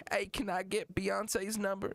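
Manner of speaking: high mock rapper voice. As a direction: ask in a high, put-on star voice. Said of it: "He was like".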